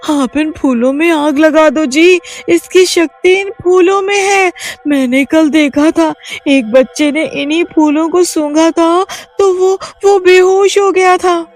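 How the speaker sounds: scared wife explaining the witch's power; frightened pleading. Acted. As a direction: stop him and point to the flowers. Reema pleads, explains flowers.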